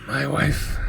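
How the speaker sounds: an eerie voice heard in a dream; growling dream voice. Acted. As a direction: utter it like a presence in a nightmare. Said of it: "you just hear this growl".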